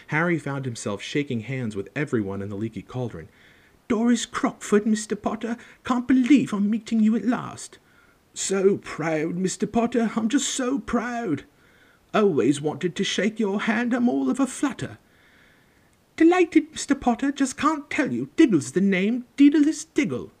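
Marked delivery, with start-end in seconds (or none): 3.9-15.0 high, gushing admirer; all of a flutter. A narrator's reading. Admirers meet Harry at last, gushing and flustered. 16.2-20.3 very high, loud gushing little man; delighted excitement. A narrator's reading. Daedalus Diggle introduces himself to Harry, thrilled and high-pitched.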